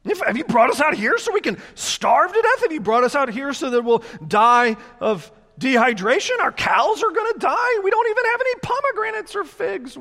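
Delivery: high, loud petulant grumbling; a hammed-up grumbling crowd complaining to Moses; whiny complainers. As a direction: whine like spoiled wanderers demanding figs. Israelites' complaint acted out.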